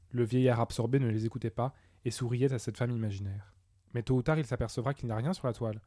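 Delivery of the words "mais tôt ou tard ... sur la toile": ordinary delivery, nothing marked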